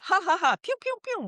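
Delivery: very high squeaky ha ha pew pew; the body releasing feel-good chemicals; playful laugh and pews. Retold or imitated. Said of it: "Every time we laugh".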